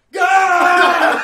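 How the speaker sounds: a very loud, high shouted "God!". Retold or imitated. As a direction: bellow the word at full power. Wizard's cry repeated.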